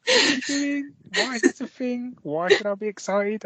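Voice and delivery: high-pitched mock complaint; mock bafflement. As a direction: play the unimpressed, baffled visitor up high. Acting out a baffled tourist.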